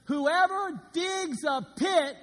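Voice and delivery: slow, loud preached verse; dramatic reading. Acted. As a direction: proclaim it slowly.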